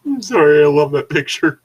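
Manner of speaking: high, loud burst of delight; excited gush. Real, not acted. Gushing over a picture.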